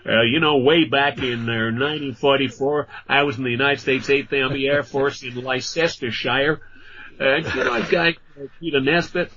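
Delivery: lightly voiced American old-timer, chatty; a hint of a Yank in a Philadelphia bar, reminiscing; American veteran. American chap recalling wartime Leicestershire.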